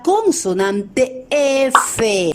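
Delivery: slow, with the "F" stretched into a long hiss of air; drawn-out F. Shown as a demonstration. Teacher introducing the F sound to children, slowly. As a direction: let "F" hiss out slowly through lip and teeth. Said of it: "el otro sonido o fonema".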